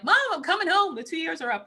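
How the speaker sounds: high, loud eager announcement to mom; excited call home. Retold or imitated. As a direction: tell mom you're coming home, bursting. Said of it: "I called my mom like".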